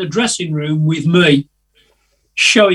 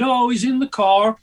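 put-on American accent; 6 semitones higher than usual. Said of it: "That was my American accent".

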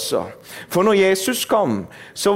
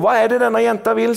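tag; 7 semitones higher, faster than usual. Retold or imitated. Stressed preacher retells impatient question.

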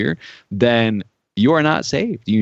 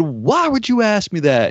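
retold angry question; 7 semitones higher than usual. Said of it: "he got so upset".

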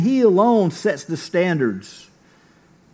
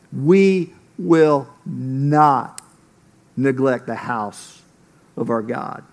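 each word laid down very slowly, pause between; slow emphatic declaration; 5 semitones lower, much slower than usual. Acted. Pastor underlining the key sentence for the congregation, slow and emphatic. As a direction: drag out "We will not" like a vow.

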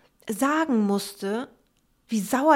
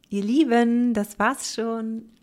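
ordinary talk, nothing marked

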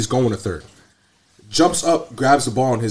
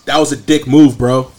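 mock angry yell; 2 semitones higher, 7 dB louder than usual. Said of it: "yells at Emmanuel".

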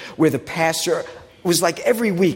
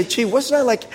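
higher, lightly voiced disbelief at growing old; a hint of mock amazement, pitched up; talking to the mirror; 8 semitones higher than usual. To your own aging face in the mirror, lightly mocking surprise. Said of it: "look yourself in the mirror and say".

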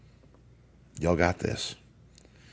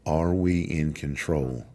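usual speaking voice

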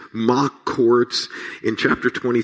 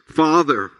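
a raised, higher cry on 'Father'; loud cry; 5 semitones higher than usual. A narrator's reading. Pastor voicing Jesus crying out to God, raised call. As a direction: call out Father as the dying Jesus. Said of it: "calling out in a loud voice".

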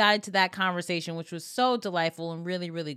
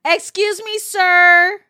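high, loud mock outrage at being called sir; mock indignant exclaim; 9 semitones higher, 11 dB louder, slower than usual. Teasing her co-host, loud mock-offended cry. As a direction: shriek it like a scandalized diva.

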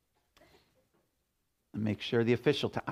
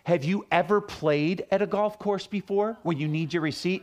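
condescending ranger; 6 semitones higher than usual. Retold or imitated. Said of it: "then he says the guy".

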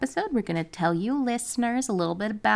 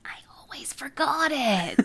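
higher breathy whisper; 9 semitones higher than usual. Real, not acted. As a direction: confess it in a whisper. Whispering reply.

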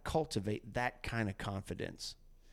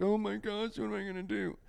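mock panic; 8 semitones higher than usual. Mock panic over life's troubles.

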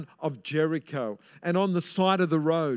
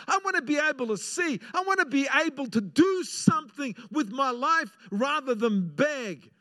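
high, earnest plea; pleading beggar; 7 semitones higher than usual. Acted. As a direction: beg for your sight.

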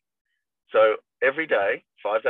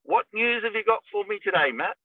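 put-on much higher, chirpy old lady voice; eager old lady; 10 semitones higher than usual. Old client greets her agent on the phone, high chirpy voice. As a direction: sing it out like a keen old client. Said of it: "Like that".

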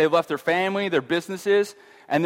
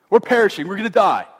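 loud, raised-pitch mock terror; hammed-up fearful wail; panicked cry; 3 semitones higher, 6 dB louder than usual. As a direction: shout it like the boat is sinking. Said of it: "they go".

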